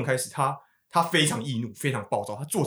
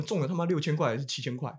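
ordinary talk

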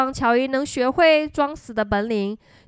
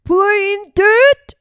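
high, loud rising question; surprised cry; 7 semitones higher, 7 dB louder than usual. A narrator's reading. Bear learns Joe was just playing dead, astonished cry. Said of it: "he cried".

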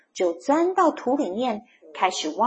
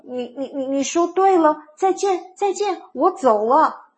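shivering; 6 semitones higher, 4 dB louder than usual. A narrator's reading. Freezing mole taking his leave, shivering. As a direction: stammer with cold, then bail out. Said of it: "冷得直打哆嗦的Morris鼹鼠先生说".

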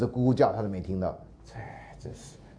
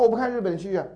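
protesting student; 9 semitones higher than usual. Retold or imitated.

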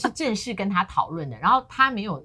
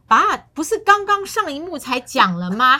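exasperated son; 6 semitones higher, 8 dB louder than usual. Retold or imitated. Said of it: "我儿子就说".

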